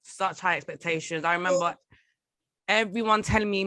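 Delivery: ordinary delivery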